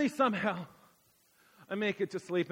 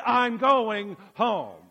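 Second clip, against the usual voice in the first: "I'm going home" slow and louder, each word drawn out; stubborn insistence; 4 semitones higher, 8 dB louder, much slower than usual. Retold or imitated. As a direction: dig in, refuse to budge. Insisting again at 2 am.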